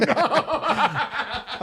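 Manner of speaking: airy high giggle; high rueful laugh. Real, not acted. Admitting he quit workshops, rueful high laugh.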